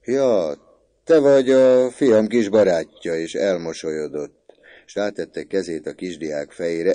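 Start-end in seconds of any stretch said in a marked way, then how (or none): 0.0-3.2 loud, higher-pitched recognition; hearty greeting. A narrator's reading. Father meeting his son's little friend, hearty greeting. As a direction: greet the boy heartily and loudly.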